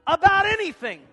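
shouted scripture, high and loud; loud preacher quoting scripture; preaching at full force. Acted. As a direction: thunder it out to the congregation. Preaching builds, congregation quotes along.

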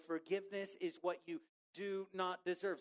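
ordinary talk, nothing marked

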